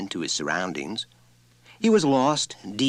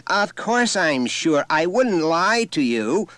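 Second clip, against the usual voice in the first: mother raised and louder, slow and indignant; offended insistence; 11 semitones higher, 7 dB louder, slower than usual. A narrator's reading. Mother to the teacher, slow and offended, louder. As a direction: hold each word up with wounded pride.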